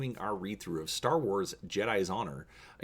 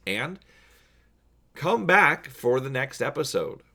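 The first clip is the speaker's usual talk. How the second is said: ordinary delivery, nothing marked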